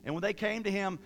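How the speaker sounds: usual speaking voice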